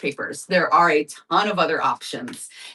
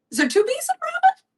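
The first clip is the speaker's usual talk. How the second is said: comic squeak of doubt, much higher; squeaky high question. Pretend. Streamer doubting her own spelling of robin, squeaky high. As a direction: pitch it way up like a confused cartoon.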